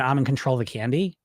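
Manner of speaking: ordinary talk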